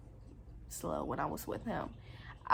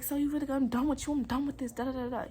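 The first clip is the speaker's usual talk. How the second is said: higher-pitched mock rant; retold yelling; 5 semitones higher, 3 dB louder, faster than usual. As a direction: imitate his yelling, tailing off into nonsense syllables. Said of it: "Yelling at me".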